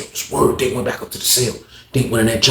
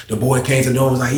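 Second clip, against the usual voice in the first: normal talking voice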